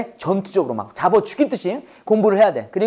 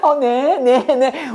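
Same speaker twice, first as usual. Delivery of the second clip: sweetly agreeable, put-on phone manner; mom's phone voice; 4 semitones higher, much slower than usual. Two-faced mom on a call, put-on politeness. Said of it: "엄마의 두 얼굴".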